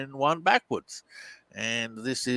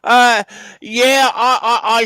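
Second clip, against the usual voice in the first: laughing; 10 semitones higher, 10 dB louder than usual. Real, not acted. Laughs before answering question.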